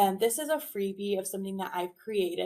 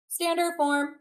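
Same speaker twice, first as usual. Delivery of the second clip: teacher call; 7 semitones higher, 5 dB louder than usual. Shown as a demonstration. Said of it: "I'll say it".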